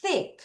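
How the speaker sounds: "Thick" with TH leaning toward Korean ㅆ; high, soft model of the TH in "Thick"; tongue out on the start of "Thick"; TH pronunciation demo. Shown as a demonstration. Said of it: "약간 쌍쇼에 더 가깝게 소리가 날 거예요".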